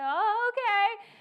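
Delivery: loud, high, comically uneasy agreement; high-pitched nervous compliance. Retold or imitated. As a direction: squeak out a reluctant acceptance, loudly. Acted reply to Lord's reassurance.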